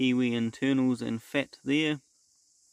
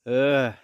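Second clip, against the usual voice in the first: disgusted groan; 5 dB louder than usual. Real, not acted. Groans at a wasted day.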